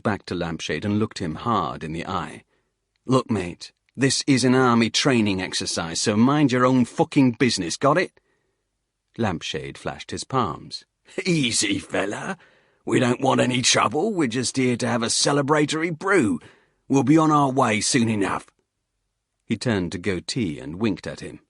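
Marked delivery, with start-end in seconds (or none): 3.1-8.2 building to a louder, higher angry snap; snapping warning. Acted. Instructor snaps at the nosy hiker, building to angry. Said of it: "Vowden snapped". 11.2-18.5 louder, higher soothing Cockney voice; calm the soldier down, Cockney style; placating Cockney hiker. Acted. Hiker calms the angry instructor in a Cockney voice.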